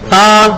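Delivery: "سا" with the tongue touching the upper teeth, no hiss; Arabic tha sound. Shown as a demonstration. Teacher shows students the tongue between the teeth, no hiss. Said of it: "زبان touch کی حالت میں ہی سا نکالنا ہے".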